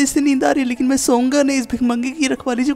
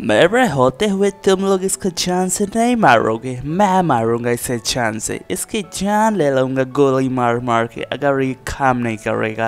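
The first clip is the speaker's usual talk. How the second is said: very low, deep villain voice; deep-voiced menacing boss; 10 semitones lower than usual. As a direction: threaten in a deep put-on voice. Villain character threatens captive.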